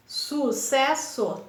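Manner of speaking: SU - CES - SO, very slow with long gaps; teacher sounding out SU-CES-SO; split into syllables. Shown as a demonstration. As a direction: say sucesso in three slow pieces, SU, CES, SO. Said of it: "Três sílabas".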